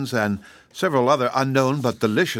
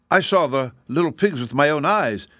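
put-on higher, insistent boy's exclamation; exclaiming; 6 semitones higher than usual. A narrator's reading. Boy objecting to the wizard's trick, exclaiming. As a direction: protest in mild astonishment. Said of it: "exclaimed Zeb".

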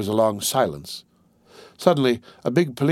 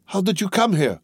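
exclaiming; 7 semitones higher than usual. A narrator's reading. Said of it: "Maria exclaimed".

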